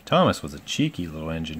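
usual speaking voice

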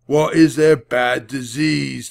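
slow, loud menacing question; gruff demanding rider. A narrator's reading. Thief rider pressing Jack about disease, slow gruff. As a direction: press the boy hard, slowly.

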